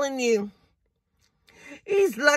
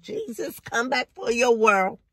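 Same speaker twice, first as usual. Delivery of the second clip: usual speaking voice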